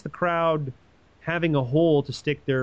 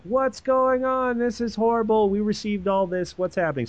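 hammed-up shocked tribesmen; mock outrage; 7 semitones higher than usual. The tribes receiving the body pieces, hammed-up alarm. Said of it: "everybody's all fired up being like".

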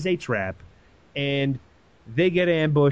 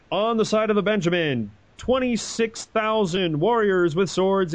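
fight announcer; 5 semitones higher than usual. Pretend. Host introducing the two armies like a boxing announcer. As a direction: hype the fighters like a ring MC. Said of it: "I'm gonna be like one of those fight style".

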